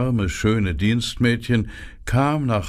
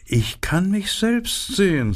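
much higher, preening flower voice; self-admiring narcissus, pitched way up; vain narcissus; 10 semitones higher than usual. A narrator's reading. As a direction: admire yourself, up high. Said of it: "sagte die Narzisse".

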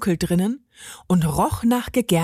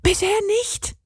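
higher, a touch breathless; gasping; 12 semitones higher than usual. A narrator's reading. Benny searches behind the counter, gasping. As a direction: pant it lightly, pitched up. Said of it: "keuchte Benny".

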